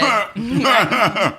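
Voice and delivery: bright burst of laughter; laughing. Real, not acted. Laughing at own joke.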